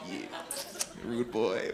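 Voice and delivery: lightly hammed-up rude boy; South London accent. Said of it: "South London's like".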